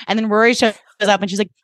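normal talking voice

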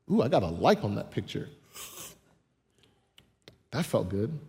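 giddy social media user. Retold or imitated. Churchgoer imitating someone thrilled by a like, cooing. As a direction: coo over a like like it's a drug.

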